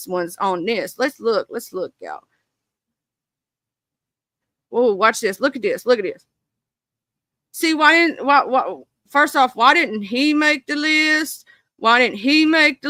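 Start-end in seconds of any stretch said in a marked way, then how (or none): none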